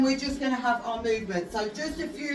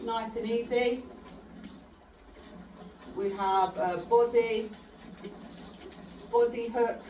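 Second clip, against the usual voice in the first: boxing coach calling combos; 5 dB quieter than usual. Acted. Coach calls punch combos.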